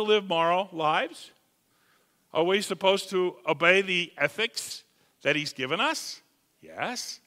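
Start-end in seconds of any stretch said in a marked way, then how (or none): none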